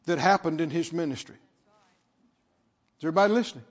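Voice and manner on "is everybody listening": normal talking voice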